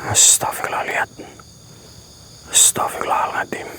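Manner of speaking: soft, hushed whisper; whispered prayer. Real, not acted. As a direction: whisper it in shock. (berbisik istigfar)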